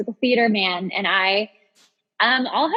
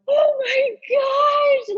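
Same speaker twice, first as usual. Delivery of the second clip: high, startled oh my gosh; a hammed-up shocked exclamation; retold shocked gasp. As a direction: squeak it out in mock horror, much higher. Retold reaction to the bride's family walking in.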